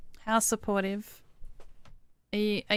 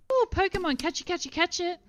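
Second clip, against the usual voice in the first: much higher, eager pleading; excited squeal; 10 semitones higher than usual. Real, not acted. Gamer spotting a rare Pokemon, excited high-pitched pleading. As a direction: urge the Pokemon to get caught, pitched way up.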